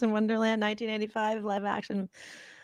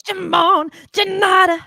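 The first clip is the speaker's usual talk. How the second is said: high, loud yelped catchphrase; 9 semitones higher, 7 dB louder, slower than usual. Retold or imitated. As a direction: squeal it like a pop star on stage. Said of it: "He goes, he goes".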